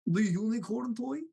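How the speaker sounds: puzzled cartoon villain asking; Gru. Shown as a demonstration. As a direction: echo it in a mock Eastern European accent. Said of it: "Have you done Gru before?".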